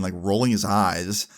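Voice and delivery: usual speaking voice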